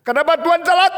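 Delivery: high, loud protest to God; acted exasperated shout; indignant complaint. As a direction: demand an explanation at full voice. Acted believer complaining God is late.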